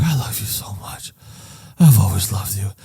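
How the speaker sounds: a touch of slurred, hushed tenderness; drunk tender confession. Retold or imitated. Drunk father to his son on the bed, mildly imitated tender confession. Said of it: "he's, like, drunk".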